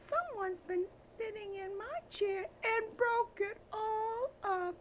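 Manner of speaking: put-on high baby bear voice complaining. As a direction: whine it like a tiny cub.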